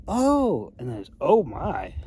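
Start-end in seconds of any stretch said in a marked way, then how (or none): 0.1-0.7 mock shocked oh. Mock reaction to a crooked blade. 1.2-1.9 mock dismayed oh my. Mock reaction to a crooked blade.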